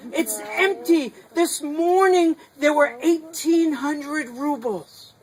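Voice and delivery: high, loud, pleading and upset; distraught stranger. Acted. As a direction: beg them to look in the empty bag. Stranger distressed, shows empty bag.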